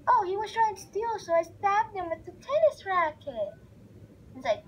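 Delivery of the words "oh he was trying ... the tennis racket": put-on high, loud boastful kid. Gamer voicing the kid bragging to his parents, high and loud. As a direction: pipe up proudly like a little kid.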